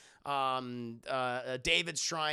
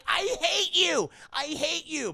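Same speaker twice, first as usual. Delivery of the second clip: much higher, hammed-up tantrum; mock furious yell; 11 semitones higher, 7 dB louder, slower than usual. Woman quarrelling with the captain, mock furious yell. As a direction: yell it slowly and high in mock hatred. Retold quarrel over Christmas trees.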